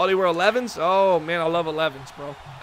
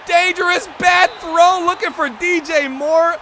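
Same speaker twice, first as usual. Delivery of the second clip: high, loud alarmed call; urgent sportscaster exclamation; excited play-by-play; 9 semitones higher, 5 dB louder, slower than usual. Acted. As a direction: call the risky throw at a high pitch. Live call of a risky throw.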